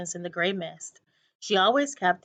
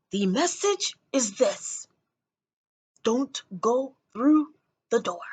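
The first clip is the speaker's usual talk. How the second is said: put-on slow secretive old man; eccentric old man; 3 semitones higher than usual. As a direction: deliver it like a dire warning. Old man's secret message.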